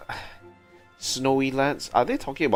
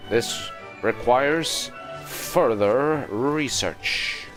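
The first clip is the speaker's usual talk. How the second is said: slow, higher mock-scholarly pronouncement; mock-serious declaration; 6 semitones higher, 4 dB louder, much slower than usual. Player sizing up the game's lore, playfully grand and slow.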